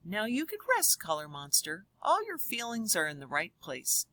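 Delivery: lively storybook voice for a class; reading to children. Acted. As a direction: read it aloud to the kids with big expression. Reads picture book to class.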